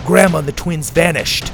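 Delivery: usual speaking voice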